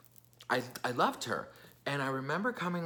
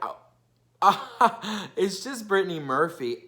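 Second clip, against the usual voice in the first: higher and louder, laughing it off; mocking laugh; 6 semitones higher, 6 dB louder than usual. Boyfriend laughs the death off to his girlfriend, mocking. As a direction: brush it off with a careless laugh.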